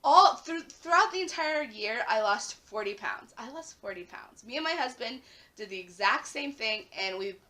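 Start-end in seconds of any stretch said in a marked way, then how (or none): none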